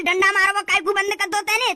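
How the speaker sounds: ordinary delivery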